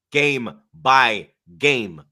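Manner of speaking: drawn-out, very slow catchphrase. Shown as a demonstration. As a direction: stretch each word out.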